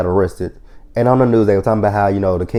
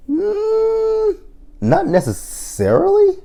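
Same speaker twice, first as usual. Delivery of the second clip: skeptical; much slower than usual. Real, not acted. Skeptical retort.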